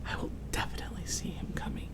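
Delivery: soft fast sleepy whisper; hushed, half asleep, eager. A narrator's reading. Boy hiding behind armchair, drowsy whisper.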